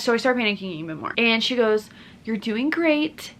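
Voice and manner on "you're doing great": slow, higher-pitched encouragement; imitated encouraging nurse. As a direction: encourage the patient slowly, as her nurse. Nurse reassures a panicking patient.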